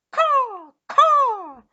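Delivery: long, high, loud caws; creature caw. A narrator's reading. Giant lizards dive toward Eric, loud high caws. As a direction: screech like a swooping beast. Imitated cries of swooping lizards.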